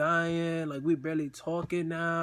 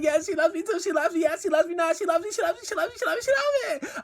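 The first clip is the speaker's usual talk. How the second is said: high, loud chant climbing higher; overjoyed yelling; 12 semitones higher, 6 dB louder than usual. Retold or imitated. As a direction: repeat it in mounting ecstatic disbelief. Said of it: "I was so fucking happy".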